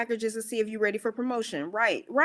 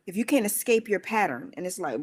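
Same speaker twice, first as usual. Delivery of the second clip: ordinary talk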